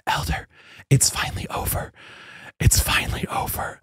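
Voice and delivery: hushed, voiceless imitation of a confiding whisper. Sister missionary confiding in elder at the end, whispered. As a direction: whisper it as if into an ear. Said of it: "she whispered in my ear".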